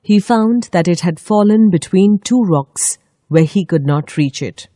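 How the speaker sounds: usual speaking voice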